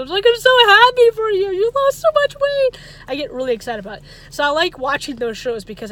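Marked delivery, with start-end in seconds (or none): 0.3-2.8 high, loud, almost crying for joy; teary happy gush. Retold or imitated. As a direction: gush with happy tears for them. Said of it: "I start almost crying for them".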